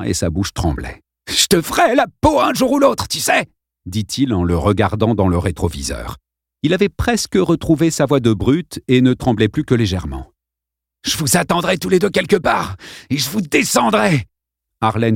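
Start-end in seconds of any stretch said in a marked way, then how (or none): 1.3-3.5 bully threat. A narrator's reading. Bully vows revenge. 11.0-14.2 bully threat. A narrator's reading.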